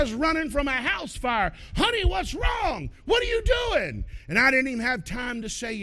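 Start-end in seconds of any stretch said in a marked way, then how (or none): 1.8-4.0 alarmed wife. Retold or imitated. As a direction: call out in alarm as the startled wife. Wife startled by husband rushing in.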